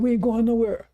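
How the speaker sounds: high-pitched mock refusal; mock defiance. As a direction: imitate someone digging in their heels. Acts out Farrakhan's defiant refusal.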